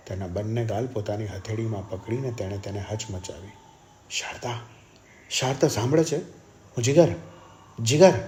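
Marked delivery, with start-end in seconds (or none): 5.3-8.1 higher, louder, insistent calls; urgent calling out. A narrator's reading. Brother calls to his unconscious sister, urgent calling out. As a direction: call to rouse someone barely conscious. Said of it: "તેણે બૂમ પાડીને કહ્યું".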